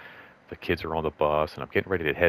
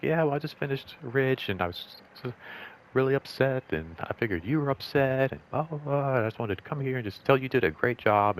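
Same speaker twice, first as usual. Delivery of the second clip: higher-pitched, earnest imitation of a colleague; imitating supervisor; 8 semitones higher than usual. Supervisor tells a colleague they did a great job, imitated in higher pitch. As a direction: voice her pouring it out, pitched up.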